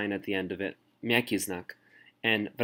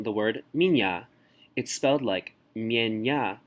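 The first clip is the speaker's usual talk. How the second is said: ordinary talk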